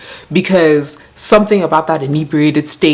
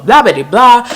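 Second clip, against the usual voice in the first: dismissive blah; 8 semitones higher, 5 dB louder than usual. Shown as a demonstration. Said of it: "I skip all that part".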